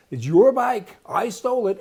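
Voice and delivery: loud, high mocking boast; mock brazen email. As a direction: shout it like a shameless thief. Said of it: "it says".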